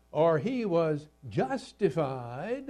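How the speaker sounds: ordinary talk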